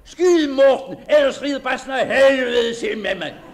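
loud high panicked yell; shout. Acted. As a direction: scream for help with a cracked laugh. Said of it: "Nu kom bassen lige ned mod mig".

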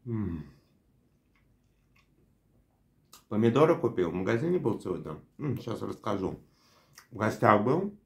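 talking between bites; chewing while talking. Real, not acted. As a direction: mouth full of food. Seen 4: Bites burger at -3; cheeks full and chewing until ~8s.

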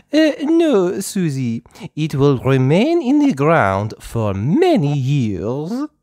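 high, slow, fluttery French teacher voice; a gentle teacher speaking slowly to little children; Madame Gazelle voice. Acted. Teacher to her playgroup, high and slow.

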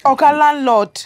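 loud, fed-up retort; sharp rebuff. Acted. Abigail snaps back at the flirting landlord. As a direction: cut him off.

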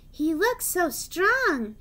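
mild excited engine voice. A narrator's reading. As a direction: gush about the big crane. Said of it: "Thomas puffed excitedly".

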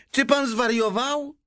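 high, outraged woman's cry; exclaiming. A narrator's reading. Woman nearby objects to the man's offer, exclaiming. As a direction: cry out in disbelief. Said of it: "wykrzyknęła jakaś kobieta".

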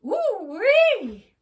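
high, loud whoop; Owl whooping. Acted. Owl whooping as the animals charge. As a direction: whoop it like a battle cry. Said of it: "Owl added".

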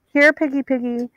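high sing-song taunt; taunting call. A narrator's reading. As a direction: call out to the hunted girl, mocking. Pursuers taunting down hall.

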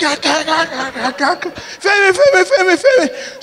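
high, urgent calling of Femi; brother rushing in. Retold or imitated. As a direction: imitate the brother running in shouting the name. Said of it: "So Dele is rushing".

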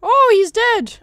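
high, loud burst of surprise; shocked excitement. Real, not acted. Player has just killed an enemy, shocked shout. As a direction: blurt it out, startled and thrilled.

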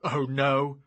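loud sarcastic scoff. Acted. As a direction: scoff in disbelief. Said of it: "I scoffed".

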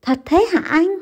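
high, eager question; hopeful relief. A narrator's reading. Wife asks Văn eagerly, hoping he is right. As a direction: ask with sudden anxious hope. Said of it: "vui mừng thấp thỏm".

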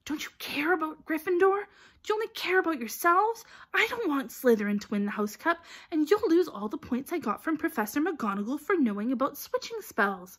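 high, forceful scolding; angry hissing. A narrator's reading. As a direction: hiss a breathless telling-off. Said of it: "hissing at them like an angry goose".

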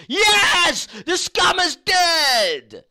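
high, loud and drawn-out crowing over a death; gleeful mock cheer. Chat cheering the streamer's death, a gleeful drawn-out shout. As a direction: crow it like a delighted hater. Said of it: "everyone would be like".

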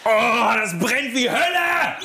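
high, slow, pained groan. Real, not acted. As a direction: drag it out through the burning. Still in pain from the stunt.

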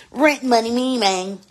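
rent money hollered high and loud; hyped shout. Real, not acted. Live streamer hyping viewers, loud excited call. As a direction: belt it out, all about the cash.

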